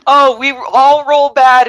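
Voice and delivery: normal talking voice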